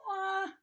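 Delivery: high, loud "wah"; acted toddler cry; yelled baby wail. A narrator's reading. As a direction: bawl like a frustrated toddler. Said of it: "he yelled".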